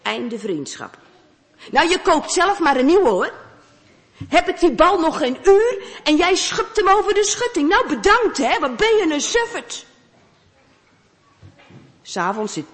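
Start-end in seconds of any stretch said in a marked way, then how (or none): 1.7-9.8 high, loud angry scolding; angry boy. Acted. Boy scolding his friend over the lost ball, angry and loud. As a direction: let him have it over the lost ball.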